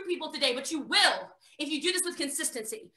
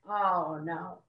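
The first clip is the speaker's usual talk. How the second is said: mock dismay; 10 semitones lower, 5 dB quieter than usual. Her dismayed reaction.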